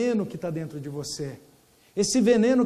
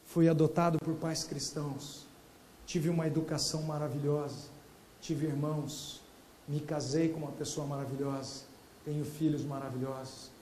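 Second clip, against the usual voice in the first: much softer and lower, as if through tears; retold tearful words; 7 semitones lower, 8 dB quieter than usual. Adopted man recalling his blessings, quietly tearful. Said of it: "E ele chorando dizia".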